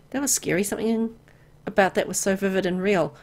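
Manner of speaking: normal talking voice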